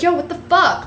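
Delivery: mock defensive retort; defensive Jersey snap. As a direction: snap back at him, Jersey style. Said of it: "I get really defensive".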